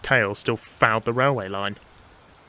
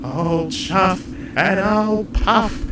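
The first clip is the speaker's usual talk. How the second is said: high, very slow chant, building louder; spooky threat; 11 semitones higher, 6 dB louder, much slower than usual. Acted. Percy as ghost threatening the shed, spooky chant. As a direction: wail the threat, stretching and swelling to the end.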